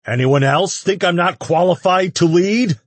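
very high, loud snarl. A narrator's reading. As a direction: challenge the crowd with a snarl. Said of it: "he snarled".

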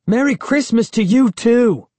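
loud, high sarcastic shout; bitter sarcastic call. A narrator's reading. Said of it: "I called bitterly".

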